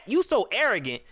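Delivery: ordinary talk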